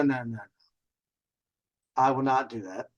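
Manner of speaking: ordinary talk, nothing marked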